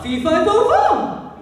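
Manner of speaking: fairy tale giant chant; fee fi fo fum. Shown as a demonstration. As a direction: boom it like the giant. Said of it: "everyone do this".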